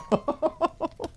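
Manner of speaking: a short, very high giggle; real squeaky giggle; amused giggle rising up. Real, not acted. Laughs at Robin the Cradle.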